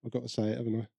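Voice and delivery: inner thought. Retold or imitated. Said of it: "I'm thinking".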